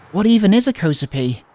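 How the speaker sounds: usual speaking voice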